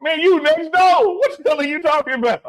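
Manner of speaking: high, loud, scoffing mock voice of the friend; mocking old friend. Retelling a friend's scoff at Mississippi, high mocking voice. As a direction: sneer it like he can't believe you're from next door. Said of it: "he turned his nose up".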